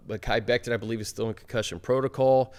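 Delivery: normal talking voice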